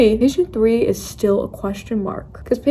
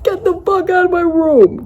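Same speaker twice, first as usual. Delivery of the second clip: retold yell; 9 semitones higher than usual. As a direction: yell at them to get out. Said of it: "I was yelling and whatever".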